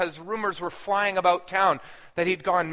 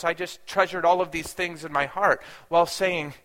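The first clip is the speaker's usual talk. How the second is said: usual speaking voice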